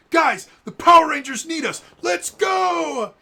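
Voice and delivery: very high, loud battle cry; rally the crowd to charge; rallying shout. Retold or imitated. Bulk rallying the civilians to fight, shouting. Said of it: "he goes".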